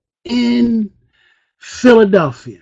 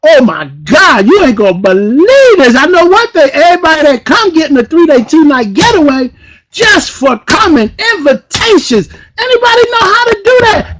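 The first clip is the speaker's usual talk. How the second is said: high-pitched, loud excitement; hyped up; 7 semitones higher, 4 dB louder than usual. Real, not acted. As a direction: sell it like the party of the year. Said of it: "Am I a little bit too hyped".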